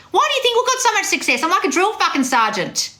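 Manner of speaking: high, insistent drill-sergeant tirade; ranting retort. Acted. Acted tirade defending her yelling.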